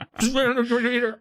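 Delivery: high, creepy killer's chuckle; creepy laugh. Retold or imitated. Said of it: "they laugh like Israel Keys".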